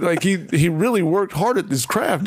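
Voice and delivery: ordinary delivery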